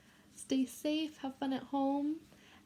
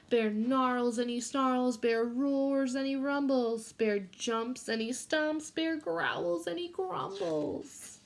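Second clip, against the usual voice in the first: put-on reading a picture book to children; gentle read-aloud for a class; story reading; 2 semitones higher, 3 dB louder, much slower than usual. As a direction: read it like storytime for little kids.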